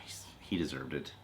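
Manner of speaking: soft unvoiced whisper. Real, not acted. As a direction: whisper it as an aside. Quiet aside while watching clip.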